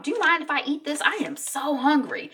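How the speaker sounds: high-pitched breezy chatter; light and casual. Retold or imitated. Wife asking the doctor if she can eat, light and casual. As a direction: imitate a carefree, chatty wife. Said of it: "all lightness and casual".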